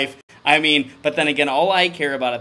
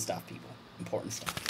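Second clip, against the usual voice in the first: whisper; 7 semitones lower, 18 dB quieter, faster than usual. Acted. Repeated aside.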